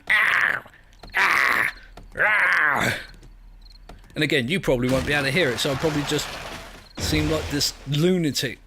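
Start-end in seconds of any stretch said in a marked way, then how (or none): 0.1-3.0 zombie noises. Retold or imitated. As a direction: shout short zombie cries. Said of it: "I can hear it going".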